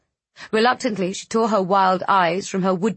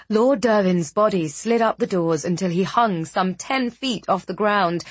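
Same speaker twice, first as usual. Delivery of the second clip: ordinary delivery, nothing marked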